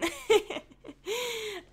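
a short, high nervous laugh; high embarrassed laugh. Real, not acted. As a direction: laugh it off, a little awkward. Laughs about running out of talk.

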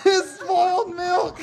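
laughing and crying out at the taste; laughing disgust. Real, not acted. Bean Boozled, gross flavor tasted.

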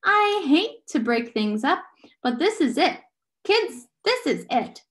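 high, loud, deliberate interruption; urgent announcement. A narrator's reading. Charles Wallace interrupts urgently.

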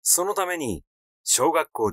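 ordinary delivery, nothing marked

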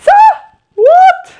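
high, loud incredulous cry; shocked. Real, not acted. Hearing she got Meliorn in the quiz, a loud shocked cry. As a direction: yelp it in disbelief.

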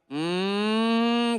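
high nasal mosquito-like hum; nasal grandma hum. Retold or imitated. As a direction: buzz it like a mosquito in the ear. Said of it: "con su voz nasal".

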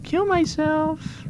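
very high, slow mock-despair voice; high silly voice. Gamer mocking his own despair in a high voice. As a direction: whine it like a cartoon character.

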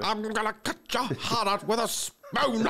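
high, slow sneering villain line; Rickman villain voice. Retold or imitated. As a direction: threaten it like the sheriff.

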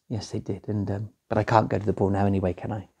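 sad quiet. A narrator's reading. Cinderella sadly admitting.

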